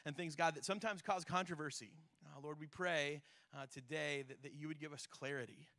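soft, low, earnest prayer voice. Acted. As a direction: pray quietly and sincerely. Opening prayer before the sermon.

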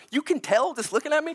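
high-pitched stressed dad; acted panic. Stressed dad answering his children, high and panicky. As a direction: let mock panic lift the voice. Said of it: "they can see the panic on my face".

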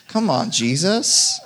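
rejoicing cry; joyful exclamation. Real, not acted. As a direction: shout it out in delight. Joyful outburst about cookies.

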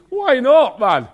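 hearty Scottish shout; Scottish exclamation. Retold or imitated. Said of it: "Only a Scottish man would say that".

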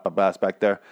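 ordinary talk

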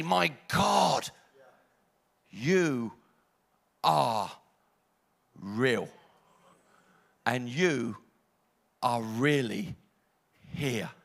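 a believer's voice cracking into awe; awed cry to God. Pretend. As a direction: cry out, then breathe the words in wonder. Preacher voicing an awakened believer.

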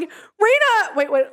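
high, pleading call of a friend's name; scared call. Retold or imitated. In the haunted house calling her friend, high scared plea. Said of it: "me in the background going".

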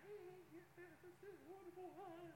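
a mock cheerful churchgoer, sugary; fake happy. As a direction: sell the fake smile hard. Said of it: "keep wanting to feel".

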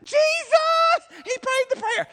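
very high, very loud overjoyed shriek; mom's ecstatic shout. Retold or imitated. Mother hearing her child prayed, shrieking with joy. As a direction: scream it like a thrilled mother. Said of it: "And she's like".

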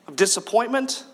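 usual speaking voice

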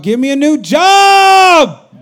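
loud high holler, stretched out on the last word; drawn-out preacher's shout; shouted demand. Acted. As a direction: bellow it like a desperate demand, dragging out JOB. Acted demand to God, shouted.

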